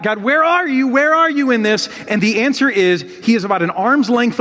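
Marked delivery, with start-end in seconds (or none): 0.0-1.9 raised, pleading cry; crying out to God. Retold or imitated. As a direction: call out to God in anguish. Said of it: "And we cry out".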